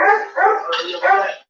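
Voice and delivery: cooing to the dog. Acted. Baby talk asking the dog.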